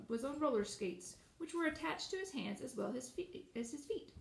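ordinary delivery, nothing marked

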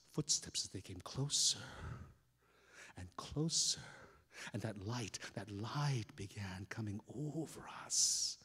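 hushed, low, slow and ominous; soft spooky storyteller; suspense narration. A narrator's reading. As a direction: build dread in a near whisper.

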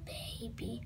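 a teasing whisper, breath only. Acted. Child whispering a tease about Daddy as a baby, whispered. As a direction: whisper the word baby under your breath.

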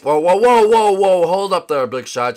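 loud repeated whoas; stopping him. Acted. Friend stops Big Shot's outro, loud whoas. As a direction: cut him off loudly.

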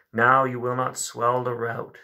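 normal talking voice

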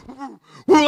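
high, loud "woo" spurts; imitated gushing noise. Billy retells the hog bleeding, high whooping spray noise. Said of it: "like a fountain".

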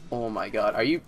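ordinary talk

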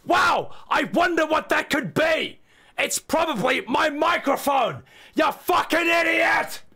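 mock wonder then blow up; sarcastic yelling. Streamer mocking viewer, sarcastic yell into rage.

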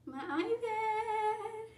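very high, slow, sing-song; delighted squeal. Real, not acted. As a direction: coo "My iPad" high and stretched out. Admiring new iPad.

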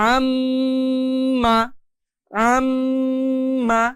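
আম্মা with the মম held long through the nose; held nasal ghunnah. Shown as a demonstration. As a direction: stretch the nasal hum in আম্মা for two counts. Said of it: "লক্ষ্য করে দেখবেন ... অনেক বেশি সময় দিয়েছি".

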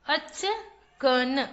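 Achkan stretched slowly as अ-च-क-न; syllable by syllable. Shown as a demonstration. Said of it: "चलिए बच्चों, पढ़ते हैं।".

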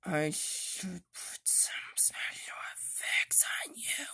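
hushed whisper to a toy; quiet secretive whisper. Acted. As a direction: breathe it softly, no voice.

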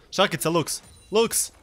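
calling out. Real, not acted. Calling teammate for ability.

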